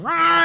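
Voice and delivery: alien roar. Retold or imitated. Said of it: "and E T's going".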